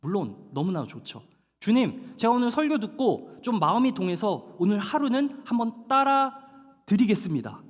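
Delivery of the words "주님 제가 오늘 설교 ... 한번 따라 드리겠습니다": eager believer. Retold or imitated. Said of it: "뭐 이런, 너무 좋죠".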